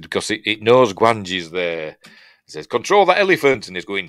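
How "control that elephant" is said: higher, louder mock command; barked order. Boss ordering the handler to control the elephant, loud mock command. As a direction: snap it at the handler.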